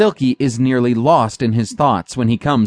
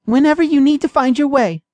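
wise mouse. A narrator's reading. Mouse character voice.

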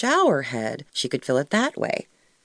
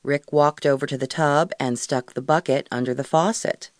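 ordinary delivery, nothing marked

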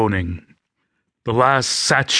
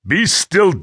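angry command; 7 semitones higher than usual. A narrator's reading. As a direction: bark it down at her. Attacker pins the woman down.

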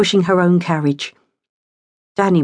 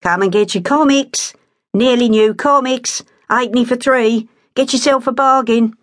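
hawker calling out; 6 semitones higher than usual. A narrator's reading. Boy hawking comics across the market, calling out. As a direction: call out to the crowd like a street seller. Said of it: "strong voice rang out".

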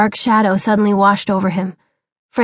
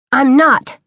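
higher, louder shout; 5 semitones higher, 4 dB louder than usual. Acted. Son finally stands up to father, shouting. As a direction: fire it back at him. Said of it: "he shouted".

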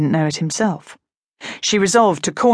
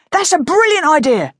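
higher, louder eager agreement; excited; 10 semitones higher, 4 dB louder than usual. Acted. Brother backing Harry's idea, higher and louder. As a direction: cheer the idea on.

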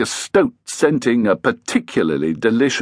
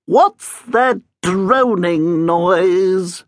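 high, slow ghostly grumble; high, slow, grumbling ghost leader; grumbling ghost Edith; 7 semitones higher, much slower than usual. Acted. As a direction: grumble it out as a cranky old ghost. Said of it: "grumbled Edith Codd".

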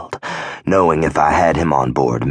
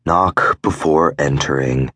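usual speaking voice

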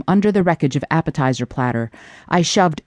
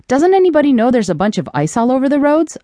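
higher and fast, fed-up rant; exasperated outburst; 8 semitones higher, faster than usual. Acted. Jamie barges into the trailer, griping, exasperated and quick. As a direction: blurt it out, fed up with the icy roads.

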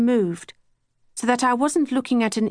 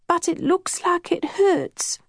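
put-on higher, slow, small childlike voice; worried twin near tears; lightly voiced teary child; tearful Kat; 8 semitones higher than usual. A narrator's reading. Crying twin answering.

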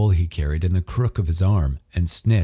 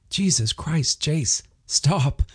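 higher, lightly voiced frustrated man; Ryder exasperated; 8 semitones higher, slower than usual. A narrator's reading. Ryder stopping his jealous partner, exasperated. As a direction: push back against the accusation.

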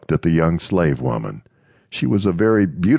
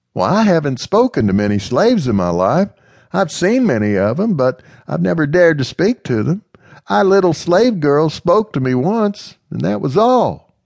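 put-on higher, louder girlish voice; young lady Amanda; 13 semitones higher, 4 dB louder than usual. Amanda confiding in Mister Smith, higher girlish voice. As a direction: voice Amanda a little higher and brighter.

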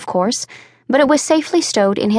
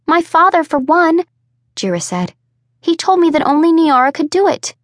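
higher, louder, voice rising in protest; too loud; 7 semitones higher than usual. Acted. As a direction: forgets to keep it down. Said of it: "Keep your voice down".

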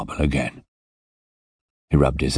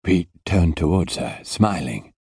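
ordinary delivery

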